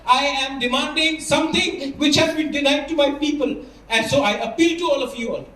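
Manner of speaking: slow, fervent appeal to the council; impassioned speech. Real, not acted. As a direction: plead for his people, every word weighed. (धीमा, भावुक भाषण)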